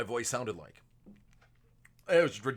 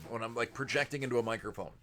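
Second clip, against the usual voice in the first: usual speaking voice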